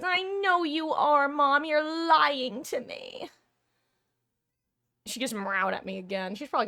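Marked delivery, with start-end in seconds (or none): 0.0-3.3 high, slow, whiny pretend cat voice; voicing the cat. Streamer voices her cat accusing her of hiding, high whiny pretend cat. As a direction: give the cat a drawn-out sulky voice. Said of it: "She goes".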